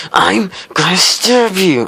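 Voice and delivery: imitating a creepy threat; mock menacing threat. Classmate threatens with scissors.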